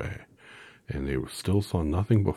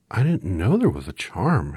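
surprised; 5 semitones higher, 7 dB louder than usual. A narrator's reading. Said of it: "answered Dorothy in surprise".

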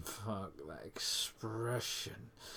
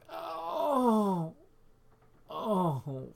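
very high, loud drawn-out moan; loud climax moan; 13 semitones higher, 11 dB louder than usual. Acted. Lover at the climax, loud high moan. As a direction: release a long loud cry at the peak.

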